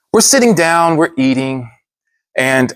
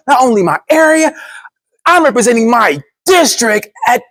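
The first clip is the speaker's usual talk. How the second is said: hyped; 6 semitones higher than usual. Retold or imitated. Hyped Instagram video.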